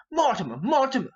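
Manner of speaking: loud, high call of the name; hollering the name. Acted. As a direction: holler it into a sleeping man's ear. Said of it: "in his ear I'll holler".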